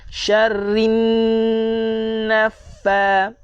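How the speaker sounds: "Syarrin naffa" with held, doubled rr and ff; sit on the shadda in "Syarrin naffa"; doubled consonants. Shown as a demonstration. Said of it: "tekankan dia dua harakat". (tekan sabdu pada "Syarrin naffa")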